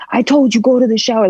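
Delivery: mother crossly repeating an order; angry retelling. Retold or imitated. Mom ordering her kid to the shower, angry. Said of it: "like, angry".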